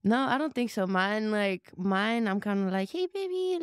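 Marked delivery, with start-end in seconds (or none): 2.9-3.6 much higher, cooing at a pet; dog-talk voice. Retold or imitated. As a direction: coo to the dog in a high voice. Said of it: "almost like Spanish accent".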